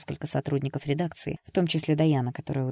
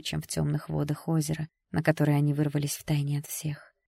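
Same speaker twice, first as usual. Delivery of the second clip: usual speaking voice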